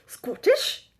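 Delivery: high, questioning stab at a Scottish accent; attempted Scottish accent. Shown as a demonstration. Vlogger retrying a Scottish accent for viewers, comically bad. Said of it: "really good example of a Scottish accent".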